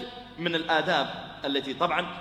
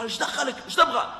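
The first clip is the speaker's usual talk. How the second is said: high, loud hostile bark; rude retort; 9 semitones higher, 3 dB louder than usual. Acted. Angry man rebuffing the greeting, loud and hostile. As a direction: snap back at him.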